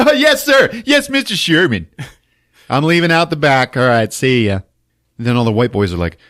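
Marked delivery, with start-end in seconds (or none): 0.1-4.6 high, louder eager yes-sir voice; fawning henchmen. Retold or imitated. Henchmen grovelling to Sherman, high eager voice. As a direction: grovel to the boss.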